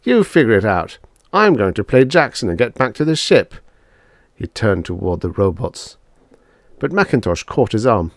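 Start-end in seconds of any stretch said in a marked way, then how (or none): 0.0-3.5 raised, louder scornful retort; dismissive and scornful; contemptuous. A narrator's reading. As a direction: brush him off with contempt. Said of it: "Drummond laughed contemptuously".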